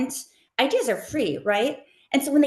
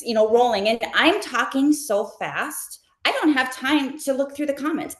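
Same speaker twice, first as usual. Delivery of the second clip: ordinary talk, nothing marked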